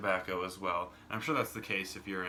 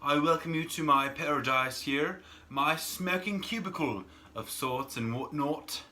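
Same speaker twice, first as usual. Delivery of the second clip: loud, higher-pitched, drawn-out mock English accent; put-on English accent; 5 semitones higher, 4 dB louder, slower than usual. American smoker welcomes UK viewers to his cubicle, put-on English accent. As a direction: greet them grandly in an imitated English accent. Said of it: "imitate and emulate the Eng-, English accent".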